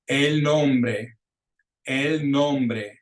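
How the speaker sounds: el nombre in clear, emphatic Spanish; model pronunciation. Shown as a demonstration. Teacher pronouncing words for students to repeat, el nombre as a clear model. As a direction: pronounce el nombre for learners, stress on NOM-. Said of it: "gonna pronounce those words for you".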